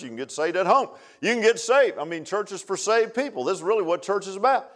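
usual speaking voice

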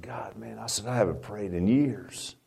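hushed confession to God; soft, slowed whisper of a prayer. Retold or imitated. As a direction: whisper it like a private prayer in bed. Retelling his first prayer.

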